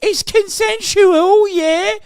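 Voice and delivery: put-on high, loud caricature British accent; mock British authorities. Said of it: "the British authorities be like".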